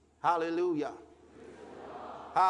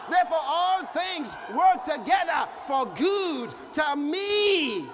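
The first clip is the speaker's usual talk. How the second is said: preaching at full force; 6 semitones higher than usual. Acted. Preacher proclaiming scripture to the congregation, full force. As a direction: shout it out to the church.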